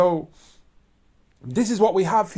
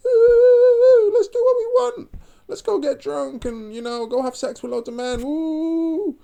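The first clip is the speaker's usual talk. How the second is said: much higher, loud excited squeal; giddy party shriek; 9 semitones higher, 5 dB louder than usual. Retold or imitated. Vlogger mocks a woman squealing to go out drinking. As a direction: shriek about going out wild.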